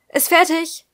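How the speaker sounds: high, loud announcement; calling out. A narrator's reading. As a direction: call it through the house. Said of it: "rief sie".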